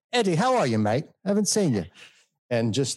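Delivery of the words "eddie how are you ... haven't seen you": put-on British accent, pitched well up; cheery off-camera British greeting, high-pitched; Hugh Laurie's British accent. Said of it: "he would turn around and he would say".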